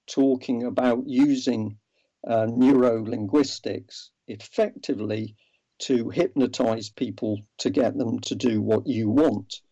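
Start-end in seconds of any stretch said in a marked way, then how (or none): none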